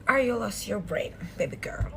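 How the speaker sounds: whisper. Shown as a demonstration.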